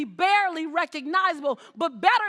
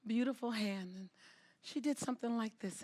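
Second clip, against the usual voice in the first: ordinary talk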